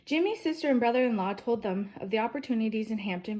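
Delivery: ordinary delivery, nothing marked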